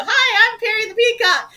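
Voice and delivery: put-on high, loud children's show greeting; hammed-up kids' mascot voice, squealing up high; Perry the Peacock. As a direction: introduce yourself big and high like a costumed show character. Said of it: "Perry the Peacock, he'd come on".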